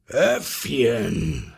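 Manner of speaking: lightly roared call in a lion voice; rufender Löwe. A narrator's reading. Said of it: "schrie da der Löwe". (mit Löwenstimme hinübergerufen)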